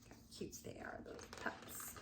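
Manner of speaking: soft whispered aww; cooing whisper. Real, not acted. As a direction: whisper how cute they are. Cooing over picture.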